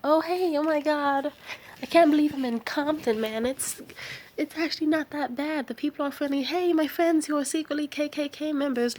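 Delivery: high-pitched, mock-enthusiastic gushing; gushing naive newcomer. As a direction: gush to your friends, voice pitched well up. Voicing a naive newcomer urging friends.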